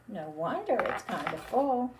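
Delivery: ordinary delivery, nothing marked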